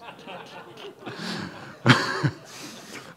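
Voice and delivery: long airy unvoiced laugh; can't stop laughing; long breathy laugh. Real, not acted. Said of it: "green screen before me".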